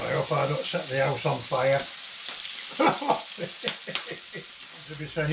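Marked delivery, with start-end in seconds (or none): 1.8-4.8 long breathless laugh. Real, not acted. Laughing at her own joke.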